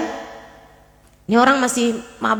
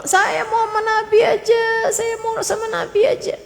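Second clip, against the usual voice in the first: high, whining insistence; imitated tearful pleading; 9 semitones higher than usual. Old man wants to stay with the Prophet, tearful pleading. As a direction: act out begging in a high cry.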